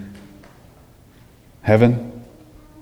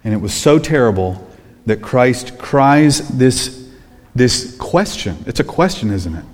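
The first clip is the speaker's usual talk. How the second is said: ordinary talk, nothing marked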